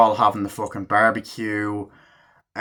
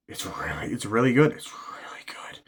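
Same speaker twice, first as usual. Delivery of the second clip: whispered savouring; 5 dB quieter than usual. Retold or imitated. Acts demon savouring the chocolate.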